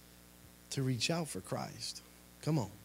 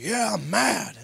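retold angry reply; 9 semitones higher, 8 dB louder, slower than usual.

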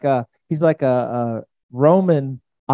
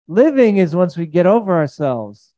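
ordinary talk

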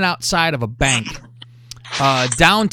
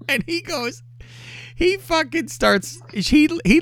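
laughing; 12 semitones higher than usual. Real, not acted. Laughing while telling the story.

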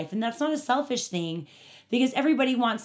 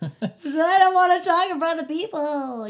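laughing; 8 semitones higher, 6 dB louder than usual. Real, not acted. Laughing about past fear of speaking.

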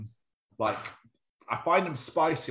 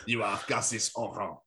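French shopkeeper; 5 semitones lower, slower than usual. Pretend. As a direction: politely refuse as a put-on Frenchman. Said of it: "They're like".

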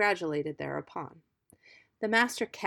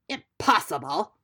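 louder, incredulous exclamation; indignant cry, lightly acted; ogre's cry; 5 dB louder than usual. A narrator's reading. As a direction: exclaim it as a mildly outraged storybook ogre. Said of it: "cried the ogre".

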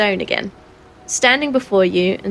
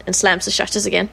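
ordinary delivery

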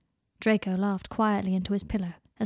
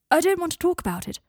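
higher, louder, quick deflection; flustered dodge; 9 semitones higher, 5 dB louder than usual. Acted. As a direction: brush it off in embarrassment. Draco flustered, embarrassed.

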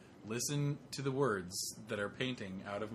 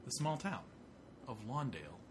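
storyteller voice; 6 dB quieter than usual. Acted. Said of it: "total A S M R right now".

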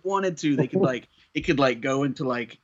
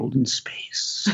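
slow, whispered tagline; mock-dramatic whisper; 2 semitones lower, much slower than usual. Adding a joke tagline to Star Wars as western, mock-dramatic whisper. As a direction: draw it out in a hushed, playful whisper.

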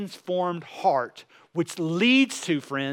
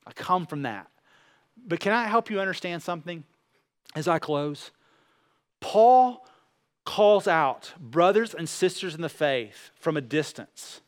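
usual speaking voice

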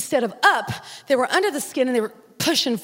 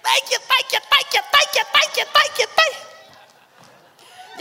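rising higher and higher, crying-laughing; ecstatic thanks; 12 semitones higher than usual. Retold or imitated. Preacher reliving her joy at the free surgery to God. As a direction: climb into a sobbing, laughing praise. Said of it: "I was crying and I was laughing".

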